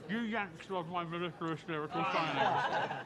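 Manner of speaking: a hint of a hammed-up posh British accent, slow; mock posh British. Answering an accent compliment with slow mock-posh British. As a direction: lay the British accent on lightly, laughing. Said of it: "I love your accent".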